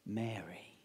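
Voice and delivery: low, soft, gentle call; tender name. Acted. Risen Jesus gently calling Mary by name, low and soft. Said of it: "what does the gardener say".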